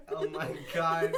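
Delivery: loud, laughing exclamation; scandalized but cracking up; laughing in disbelief. Real, not acted. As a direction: blurt it out loudly through laughter. Others crack up at a jab.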